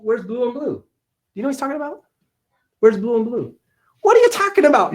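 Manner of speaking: high mock-indignant "what are you on about"; mock bafflement. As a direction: play it big, pretend you have no idea what they mean. Mock confusion at a viewer's comment.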